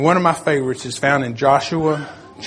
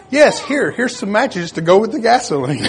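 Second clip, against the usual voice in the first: joking quip; 6 semitones higher, 3 dB louder than usual. Retold or imitated. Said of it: "he makes a joke".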